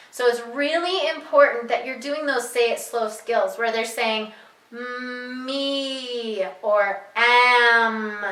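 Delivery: ordinary delivery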